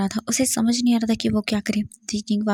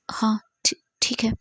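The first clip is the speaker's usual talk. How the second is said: broken whisper; 3 dB quieter than usual. A narrator's reading. Crying character gives in.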